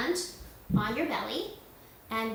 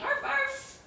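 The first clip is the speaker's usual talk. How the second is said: "Arf, arf" yipped very high like a small dog; high little doggy yaps; puppy barks; 3 dB louder than usual. Pretend. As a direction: bark like a playful puppy for the kids. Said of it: "Shake your tail and say".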